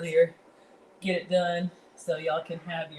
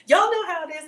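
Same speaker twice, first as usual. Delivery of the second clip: excited burst; 9 semitones higher, 8 dB louder than usual. Real, not acted. Burst of exclaiming to chat.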